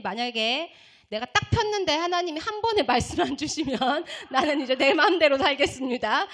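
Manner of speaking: loud ultimatum to God; defiant prayer. Retold or imitated. Student bargains with God over one verse, defiant praying. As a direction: lay down terms, raising the voice. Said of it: "손에 들고 기도했어요".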